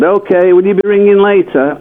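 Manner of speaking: high-pitched imitation of mum; lightly caricatured high mum voice; mother's voice.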